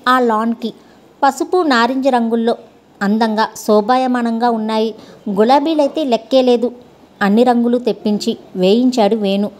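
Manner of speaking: ordinary delivery, nothing marked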